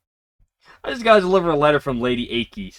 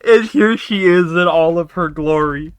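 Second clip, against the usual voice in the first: much higher, slow, near tears of joy; squealing delight; 7 semitones higher, 5 dB louder than usual. Real, not acted. Player gushing that Iku is back, high slow squeal. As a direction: gush over her return.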